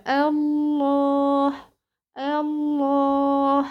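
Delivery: الله drawn out very slowly with a thick, heavy lam; heavy lam in Allah. Shown as a demonstration. Teacher showing student the heavy lam, slow recitation. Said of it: "عليا التغليظ في لفظ الجلالة".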